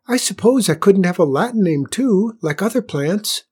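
high, breathy, eager flower voice; small whispery wheedling voice; hopeful little flower. A narrator's reading. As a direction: ask shyly and hopefully, pitched high. Flower timidly asks for more.

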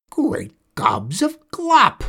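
shrieking high, loud and slow; a startled old witch yelling. A narrator's reading. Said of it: "she yelled".

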